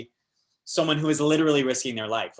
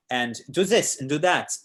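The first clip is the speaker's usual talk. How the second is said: light mock-French accent, to the point; 3 semitones higher, 3 dB louder than usual. Actor imitating a restaurant coworker, French accent. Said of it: "she's French".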